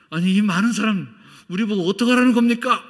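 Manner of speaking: high, loud exasperated protest; loud incredulous complaint; dismayed protest. Retold or imitated. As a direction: protest in disbelief.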